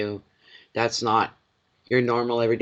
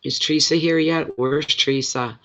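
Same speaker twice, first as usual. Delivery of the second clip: normal talking voice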